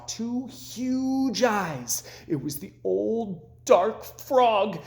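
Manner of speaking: slow, drawn-out suspense as the eyes appear; spooky storytelling. A narrator's reading. Frog tells Toad a ghost story, slow and spooky. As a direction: stretch each word to build dread.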